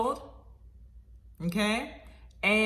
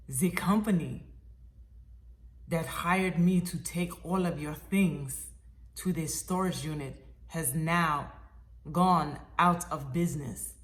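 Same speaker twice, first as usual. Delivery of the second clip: put-on low, deliberate Russian accent; halting, measured Russian-accented speech; 3 semitones lower than usual. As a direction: imitate a Russian mover breaking news. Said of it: "in my best Russian accent".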